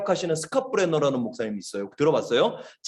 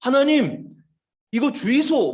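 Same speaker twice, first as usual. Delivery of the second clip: higher, imploring Gyeongsang dialect prayer; 8 semitones higher, slower than usual. Retold or imitated. Missionary imitating prayer heard from behind, Gyeongsang dialect. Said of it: "경상도 사투리로 기도하는 소리가".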